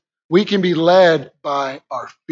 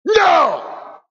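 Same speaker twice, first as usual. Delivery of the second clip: shouted refusal; 9 semitones higher than usual. Pretend. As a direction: reject the fear with a forceful shout. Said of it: "you gotta go".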